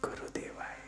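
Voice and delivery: mantra barely breathed on the lips; lip whisper. Shown as a demonstration. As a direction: whisper the chant without making noise. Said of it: "अब होठों में बोलो". (होठों में फुसफुसाकर जाप)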